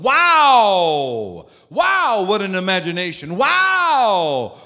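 big, loud, drawn-out wows; over-exaggerated amazement. Shown as a demonstration. Preacher showing how to praise a child, wildly exaggerated amazement. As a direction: swoop high and fall slowly on "Wow". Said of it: "I'm over-exaggerating".

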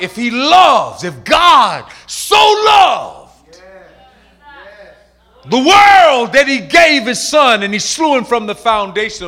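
high, loud, slow preacher's cry; preaching shout. Acted. Preacher driving home God's love to congregation. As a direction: preach it at full force.

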